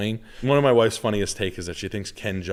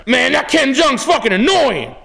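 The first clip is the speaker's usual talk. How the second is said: very high, slow mock shout; wife impression; 8 dB louder, slower than usual. Husband repeating his wife's TV complaint, shouted high impression. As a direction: bellow the complaint as a caricature wife. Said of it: "That's her to me".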